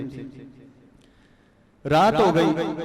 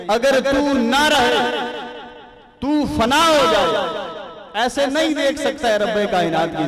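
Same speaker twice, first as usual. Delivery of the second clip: full-force preaching; 9 semitones higher, 6 dB louder than usual. Real, not acted. Preacher urging listeners to erase the self, full-force preaching. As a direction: press each clause home forcefully.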